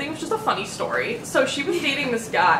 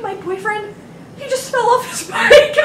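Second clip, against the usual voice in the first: much higher, laughing, overdramatic roommate; mock her panic, climbing higher; frantic roommate; 11 semitones higher, 5 dB louder than usual. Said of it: "She's like".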